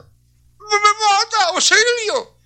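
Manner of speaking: high, panicked cry for help, half acted; a mother screaming as she's being strangled; retold scream for help. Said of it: "la mujer gritando".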